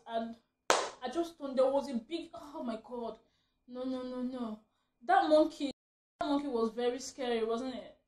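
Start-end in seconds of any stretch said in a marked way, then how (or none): none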